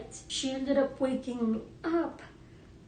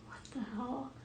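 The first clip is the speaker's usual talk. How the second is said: retold quiet reaction; 9 dB quieter, faster than usual. Retold hushed reaction at night.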